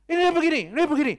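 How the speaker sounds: high, loud nagging tirade; angry scolding father. Acted. Papa keeps on at his frightened child, angry and loud.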